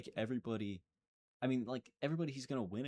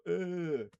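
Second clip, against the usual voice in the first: short higher grunt of pain; mock pain grunt; 9 semitones higher, 6 dB louder than usual. Mocking a French fighter's pain grunt. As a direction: grunt like you've been hurt. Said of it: "They go".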